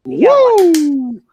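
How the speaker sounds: high, loud whoop of delight; excited whoop. Real, not acted. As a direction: let out a loud, gleeful woo. Reacting to the wild clip.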